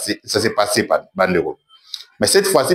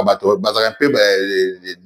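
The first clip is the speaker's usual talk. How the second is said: usual speaking voice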